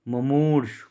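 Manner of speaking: मुमूर्ष ending on the "rsh", no final u; corrected form. Shown as a demonstration. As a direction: close मुमूर्ष on the consonants, "mu-moorsh". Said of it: "मुमूर्षु नहीं, मुमूर्ष होता है".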